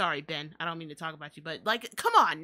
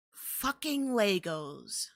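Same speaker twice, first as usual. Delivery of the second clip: slow contempt; 2 semitones lower, much slower than usual. Real, not acted. Slowed for contempt.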